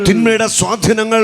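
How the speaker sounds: high, loud declaratory cry; full-force prayer. Real, not acted. Preacher leads congregation line by line, loud fervent proclamation. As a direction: call it out strongly for the crowd to echo.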